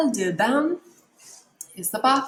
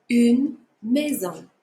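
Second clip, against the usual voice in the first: une maison slow and a bit louder; slow repeat; 4 dB louder, slower than usual. Shown as a demonstration. Teacher repeats une maison slowly for students to echo. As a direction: give une maison slowly for them to copy. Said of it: "Repeat it at home".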